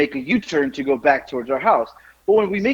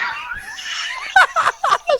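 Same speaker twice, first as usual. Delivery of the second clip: high, breathless burst of laughter; helpless laugh. Real, not acted. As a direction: crack up and can't stop. Can't get story out laughing.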